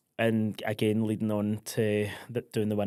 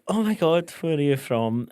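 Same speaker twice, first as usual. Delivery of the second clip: high, loud tipsy gushing; delighted squeal of a question; excited gush; 6 semitones higher, 7 dB louder than usual. Retold or imitated. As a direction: gush at a stranger's accent. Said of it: "So I was out and I was like".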